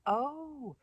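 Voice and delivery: long, high swooping "oh"; drawn-out, exaggerated understanding; sudden realization. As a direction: let the penny drop slowly. Acted dawning realization.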